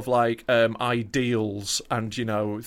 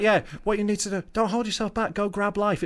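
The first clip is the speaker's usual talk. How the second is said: higher-pitched mocking imitation of an ex; mocking ex-girlfriend; 6 semitones higher than usual. Comedian retelling his ex's letter, mocking voice. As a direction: quote the ex with breezy condescension. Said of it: "And then she's like".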